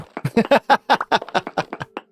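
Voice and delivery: long high-pitched burst of laughter; big laugh. Real, not acted. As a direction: crack up helplessly at a joke. Cracks up at joke.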